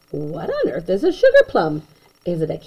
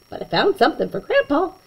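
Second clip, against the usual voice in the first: plain reading voice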